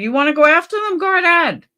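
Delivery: a lightly voiced sarcastic dare; daring go-ahead. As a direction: egg them on, high and mocking. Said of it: "I said".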